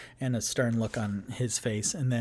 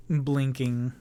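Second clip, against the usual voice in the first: normal talking voice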